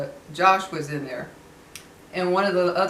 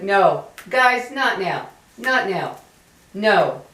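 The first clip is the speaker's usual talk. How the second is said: real scolding; 2 semitones higher, 6 dB louder, slower than usual. Real, not acted. Mother stopping her kids mid-recording, firm louder scold. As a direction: cut them off firmly, real annoyance.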